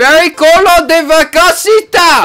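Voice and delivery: high, slow mock Spanish exclamation; hammed-up fake Spanish phrase. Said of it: "There's your Spanish lesson".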